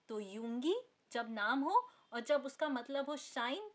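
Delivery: ordinary talk, nothing marked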